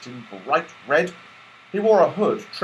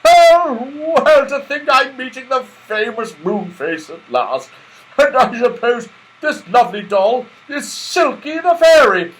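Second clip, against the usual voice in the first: delight; 12 semitones higher, 9 dB louder than usual. Acted. Santa Claus eager to go, high, loud delight. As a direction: burst with joy.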